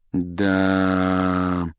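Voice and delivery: long, level drawl; drawn out. A narrator's reading. Ron after the engine dies in total silence, drawn out. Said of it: "протянул Рон в полной тишине".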